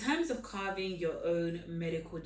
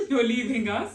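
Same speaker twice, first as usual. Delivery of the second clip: higher and louder, through a laugh; 6 semitones higher, 10 dB louder than usual. Real, not acted. Host to guest who is leaving Ghana, laughing. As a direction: laugh as you repeat it.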